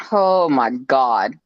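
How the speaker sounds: normal talking voice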